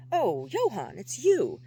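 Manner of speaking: high, loud burst of startled relief; startled exclamation. A narrator's reading. Chaz caught off guard by Johann's arrival, startled exclamation. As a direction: exclaim it, relieved but unnerved. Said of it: "both relieved and unnerved".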